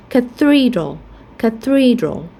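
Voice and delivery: cathedral stressed on THE, long E; stress on second syllable. Shown as a demonstration. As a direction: say cathedral as ca-THE-dral, middle syllable strong. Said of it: "we stress in the second syllable".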